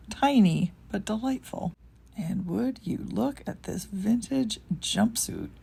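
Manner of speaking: ordinary talk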